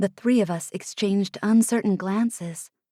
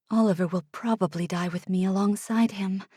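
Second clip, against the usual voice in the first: usual speaking voice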